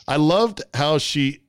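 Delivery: ordinary talk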